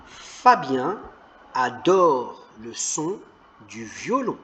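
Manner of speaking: mots détachés, très lentement; déchiffre lentement pour les enfants; lecture lente. Shown as a demonstration. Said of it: "Très bien". (lecture hachée, mot à mot)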